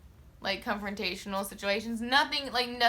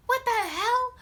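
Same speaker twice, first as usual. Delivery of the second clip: much higher, loud "What the hell?"; mock angry mom; 13 semitones higher, 3 dB louder, slower than usual. Mom on the phone with school, hammed-up high outrage. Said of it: "the type of mom to literally be like".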